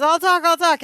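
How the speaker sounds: hammed-up cry to be let out; panicked [name]. [name] grabbing the cell bars, mock panic.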